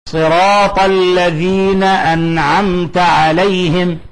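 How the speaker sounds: slow chanted verse, noon and meem of "أنعمت" each sounded clearly; clear izhar recitation. Shown as a demonstration. Teacher demonstrating both izhar rules in the verse, clear slow recitation. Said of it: "الإظهار الشفوي، الميم مع التاء".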